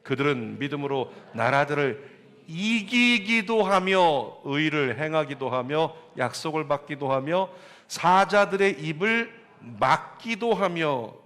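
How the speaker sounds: measured, drawn-out reading of the verse; slow scripture recitation. Acted. Pastor leads congregation in slow scripture reading. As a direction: read each word slowly like scripture.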